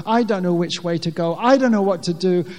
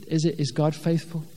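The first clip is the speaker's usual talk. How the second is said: ordinary talk, nothing marked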